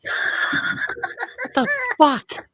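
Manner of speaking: very high, squeaky retold outrage; hammed-up falsetto shock; mock disbelief. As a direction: squeal it slowly in pretend horror. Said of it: "and yells".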